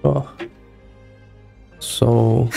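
ordinary delivery

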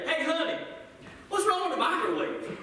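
playful helpless husband asking for help; calling to wife. Retold or imitated. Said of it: "I would say".

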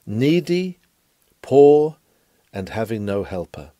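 usual speaking voice

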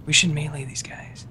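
hushed whisper. Real, not acted. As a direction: whisper so enemies won't hear. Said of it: "We're still whispering".

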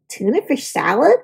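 ordinary delivery, nothing marked

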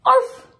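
high, loud single arf; puppy yap. Acted. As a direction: yap once like a tiny pup. Pup barks in picture book.

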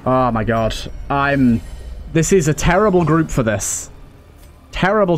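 usual speaking voice

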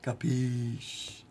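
Capiche with a different mock Italian mobster color; Capiche in a mafia Italian accent. Asking chat how to say it, mock mafia Italian. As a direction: lean into the Italian mob voice on Capiche. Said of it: "Do I have to say it like that?".

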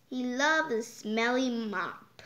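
ordinary delivery

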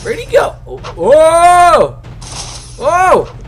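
high panicked yelps; panicking gamer. Real, not acted. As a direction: panic as you're chased. Chased by monster in game.

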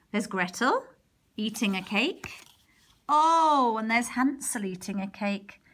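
plain reading voice

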